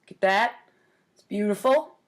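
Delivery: usual speaking voice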